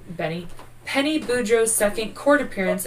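usual speaking voice

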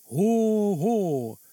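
a listless, hollow Santa "Hoo, hoo"; apathetic Santa ho ho. Acted. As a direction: go through the Santa laugh with no joy at all. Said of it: "hän totesi apaattisena". (välinpitämätön, väsynyt joulupukin "Hoo, hoo")

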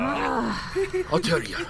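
put-on slow, high slurred drunk; town drunk. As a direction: drag it out, drunk and garbled. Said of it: "his words garbled".

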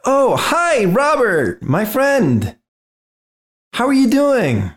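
high-pitched, sing-out greeting to a friend; friendly intruder. Acted. Intruder hailing his friend Robert, over-friendly.

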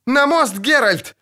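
high-pitched urgent shout; крик. A narrator's reading. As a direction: cry out an urgent command. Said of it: "крикнула чародейка". (пронзительно крикнуть, командуя)

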